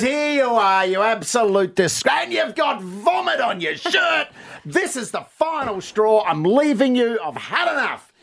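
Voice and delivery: high-pitched, hammed-up angry wife; wife losing it. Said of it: "she starts to lose it".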